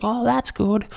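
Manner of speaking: high, chirpy imitation of a lady on speakerphone; overheard phone caller. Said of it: "She's like".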